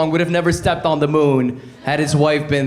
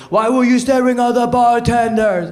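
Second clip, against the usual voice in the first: higher, suspicious wife; 8 semitones higher than usual. Acted. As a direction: mimic the wife's accusing question. Wife questioning in acted quarrel.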